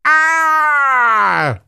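high, loud yelp of pain; pained giant shout. A narrator's reading. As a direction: yelp as if your foot was bitten. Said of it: "cried the giant".

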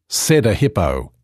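usual speaking voice

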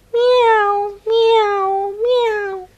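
very high squeaky meows; kitten meows. Acted. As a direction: mew eagerly like a hungry kitten. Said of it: "said the hungry little kitten".

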